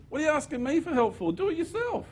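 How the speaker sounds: a high, breezy celestial voice telling him to do it himself; archangel's reply. Retold or imitated. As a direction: play the archangel tossing the question back, high. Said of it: "And he said".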